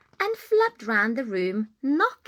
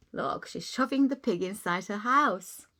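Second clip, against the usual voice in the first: usual speaking voice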